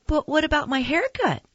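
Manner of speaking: put-on high-pitched, anxious child's question; pleading child. Boy not wanting to go home, pleading in a high voice. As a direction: plead upward in a small kid's pitch.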